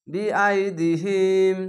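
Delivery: বি আই দি হিম read slowly, the long vowel stretched one alif; elongated vowel. Shown as a demonstration. Teacher reading a word to students, slow with the vowel stretched. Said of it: "এক আলিফ টান".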